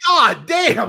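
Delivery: high, loud "God damn"; stunned reaction. Retold or imitated. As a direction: react to the bone coming out. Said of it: "I was like".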